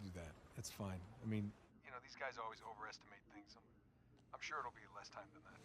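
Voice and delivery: hushed lie. Acted. Hiding the truth from his wife.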